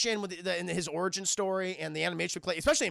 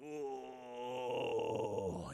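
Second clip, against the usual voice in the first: low, soft, drawn-out monster groan; eerie moan; 8 semitones lower, 10 dB quieter than usual. Retold or imitated. Body double hearing Matt in the car, low eerie moan. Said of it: "you just hear this".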